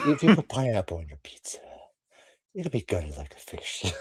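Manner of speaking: whispered, quiet killer threat; Ghostface whisper. Retold or imitated. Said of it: "he answered as his voice".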